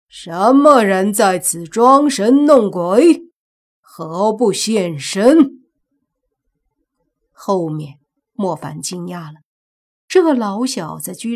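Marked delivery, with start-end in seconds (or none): 0.1-5.6 loud, slow, commanding shout; booming challenge. Acted. Family head challenges whoever is playing ghost, booming shout. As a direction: draw breath and bellow each word slowly. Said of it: "随即提气大喝".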